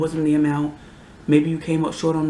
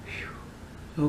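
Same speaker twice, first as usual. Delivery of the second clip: calming exhale; 11 dB quieter than usual. Real, not acted. Calming herself down.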